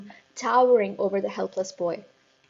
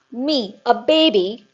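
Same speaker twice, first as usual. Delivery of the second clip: slow outraged shout, louder; 3 semitones higher, 10 dB louder, slower than usual. A narrator's reading. Headmistress shouting at the boy, slow and outraged. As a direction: bellow it in disbelief. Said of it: "shouted the Trunchbull".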